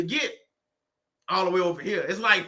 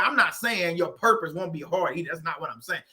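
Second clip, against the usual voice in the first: ordinary delivery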